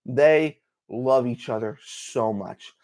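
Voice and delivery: slow, quiet, heartfelt repeat; slow emphasis. Real, not acted. Fan stressing how much Gambit and Rogue love each other, slow emphasis. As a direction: let each word land slowly.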